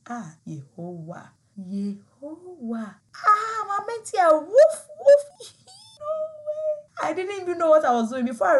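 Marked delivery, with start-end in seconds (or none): 0.1-3.0 slow, soft horrified prayer; hushed dread. Retold or imitated. Hearing the dog bark. 3.3-7.1 much higher, much louder woof woof; mock barking dog. Mimics dog barking and fright.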